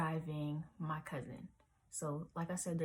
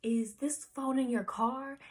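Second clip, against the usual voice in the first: surprised; 7 semitones higher, 6 dB louder, slower than usual. Retold or imitated. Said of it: "she was like".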